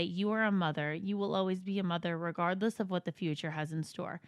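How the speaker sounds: soft, low-key letter reading; reading aloud. Acted. Reading a friend's Mother's Day note, soft reading.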